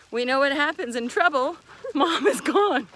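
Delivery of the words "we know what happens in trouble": ordinary talk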